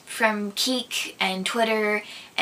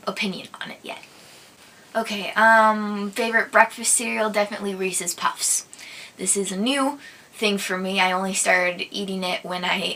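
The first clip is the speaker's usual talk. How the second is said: normal talking voice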